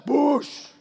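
explosive "boosh" sound effect; loud crashing-water whoosh. Retold or imitated. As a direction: imitate a wave slamming down. Imitates the sea crashing down.

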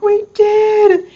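high, loud, beaming reply; excited answer. Retold or imitated. To the lady opening her present, loud excited reply. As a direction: sing it out with delight.

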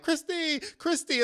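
high, loud mock fan calls; calling out. Fans on the street calling to Christy, high and loud. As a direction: call her name across the street.

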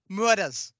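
"Murders" in a put-on accent, r's dropped; character's accent. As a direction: imitate his accent on "Murders", MOO-uh-duhz. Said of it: "he's got that accent".

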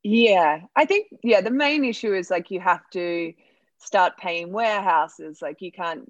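normal talking voice

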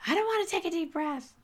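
put-on very high, quick kid's whine; whiny refusal. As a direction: play a balking child in a squeaky high voice. Student voiced refusing a deep breath.

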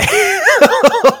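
high loud laugh; burst of laughter. Real, not acted. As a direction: crack up laughing. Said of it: "Oh, boy".

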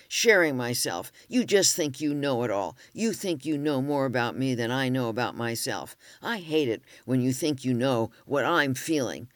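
loud, accusing rant; retold angry yell. Husband turning it around on the therapist, loud and angry. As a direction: hammer her with every complaint. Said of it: "he yelled".

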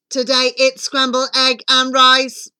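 loud monotone mirror voice; magic mirror intoning on a single pitch; talking mirror. A narrator's reading. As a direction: answer like an announcing machine, all on one note. Talking mirror answers in story.

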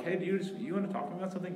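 high, fast prodding question; coaxing. Retold or imitated. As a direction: nudge them to spit it out. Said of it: "I'm like".

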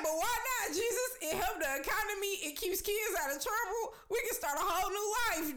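hammed-up high salesman voice selling the idea; high-pitched sales pitch. Retelling South Park, the dad pitching crack to Jesus in a high, pushy voice. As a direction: voice jumps way up, pushy and pleading, fast at the end. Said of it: "He was like".

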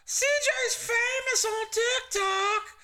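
very high, slow excited announcement; excited wife. Retold or imitated. Wife announcing the TikTok fame, high and drawn out. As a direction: draw it out up high like the thrilled wife. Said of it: "She's like".

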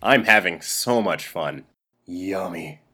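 low, slow, flat canned clip voice; deadpan spliced-in voice; flat robotic clip voice. Pretend. Post Brandon edited-in voice.

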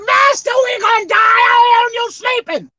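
high, loud cry to the master; panicked shout. Acted. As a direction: wake the sleeping master in panic. Disciples panic, retold.

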